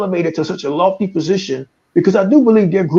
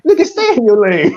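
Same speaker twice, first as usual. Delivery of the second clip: mock outrage; 8 semitones higher than usual. Imitating a friend told to refuse a promotion, higher mock outrage. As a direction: squeal it like you're offended. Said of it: "you be like".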